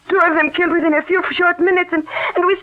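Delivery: high and fast, tumbling out in panic; frantic, rising panic; distraught. Acted. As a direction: rush the words as fear takes over. Distraught after second murder.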